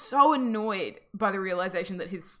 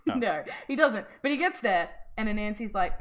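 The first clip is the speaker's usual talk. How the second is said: ordinary delivery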